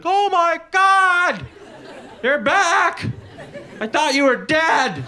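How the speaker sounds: dog overjoyed to see owner return; ecstatic dog. Retold or imitated. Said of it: "He'll come running down the stairs like".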